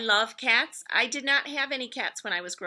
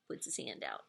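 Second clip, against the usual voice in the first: breathy hushed whisper; teacher whispering a funny cat story to kids; 15 dB quieter than usual. Retold or imitated. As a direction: whisper it like a secret about the sneaky cat. Acting out sneaky cat at food.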